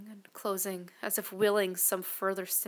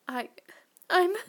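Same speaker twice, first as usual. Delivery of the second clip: halting, breath-caught stammer; gasping; 10 semitones higher, 10 dB louder than usual. Acted. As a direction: gasp it out, voice out of control. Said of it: "I gasped out, unable to control my voice".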